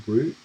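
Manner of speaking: route pronounced "root"; root pronunciation. Shown as a demonstration. Streamer showing their own habit, route as "root". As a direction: say route with a long oo. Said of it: "I kind of say".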